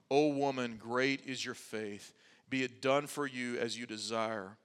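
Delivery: low, soft, tender pronouncement; quiet, reverent reading of a blessing; gentle solemn answer. Retold or imitated. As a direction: grant it gently, voice dropped low. Jesus' gracious answer, read softly.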